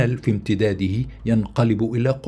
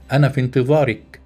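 usual speaking voice

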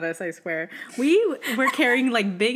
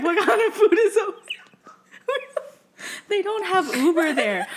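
laughing; 9 semitones higher than usual. Real, not acted. Giggling retelling of hungry night.

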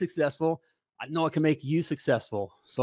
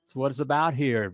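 usual speaking voice

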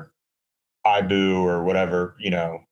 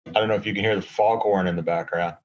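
ordinary delivery

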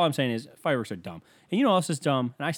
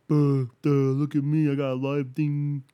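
louder, dopey voice; dumb mocking voice; 4 semitones higher, 7 dB louder, slower than usual. Comedian mocking neighbors lighting fireworks late at night. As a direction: play them as slow-witted, dopey show-offs.

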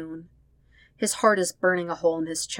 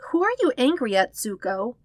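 lightly voiced Ty Lee, much higher on the question; a higher, pointed question to Zuko; Ty Lee asking; 6 semitones higher than usual. Ty Lee presses Zuko about his anger, lightly voiced, higher. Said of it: "Ty Lee asks".

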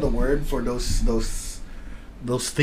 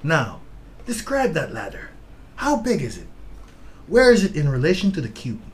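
guided meditation voice; 5 dB quieter than usual. Acted. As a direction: paint the scene calmly, with pauses. Reads the test like a guided meditation.

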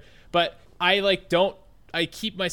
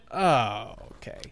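flat disapproving okay; 5 semitones lower, slower than usual. Retold or imitated. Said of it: "that was exactly how she said it".

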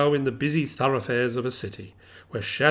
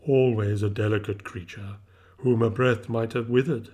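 a lower, solemn spirit; ghost voice; 3 semitones lower than usual. A narrator's reading. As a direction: speak low as the ghost. The ghost speaks.

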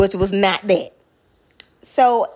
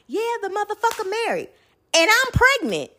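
retold angry reply, pitched much higher; high angry quote; 11 semitones higher than usual. Wife telling the girlfriend off, high and angry. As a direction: spit it out high and fast.

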